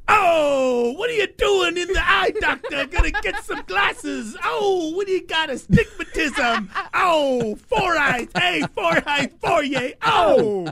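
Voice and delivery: hammed-up Italian teasing, shouted; Italian accent. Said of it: "He's like".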